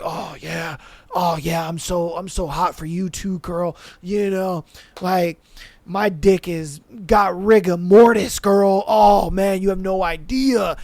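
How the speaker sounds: mock lovestruck dude voice; dopey dude impression. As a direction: play him low and dazed, drooling over her. Mocking homeboy, dopey.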